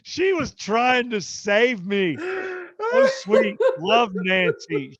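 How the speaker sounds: real high-pitched, loud laughing cry; cracking up. Real, not acted. Storyteller on mother-in-law trying to save him, really cracking up loudly. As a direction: gush through laughter, voice shooting up.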